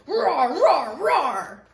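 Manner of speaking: loud rolling bear roars; roaring bear. A narrator's reading. Bear stomping through the meadow, loud roaring. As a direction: roar out each one in turn.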